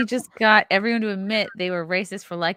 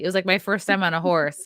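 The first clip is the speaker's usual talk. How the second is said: normal talking voice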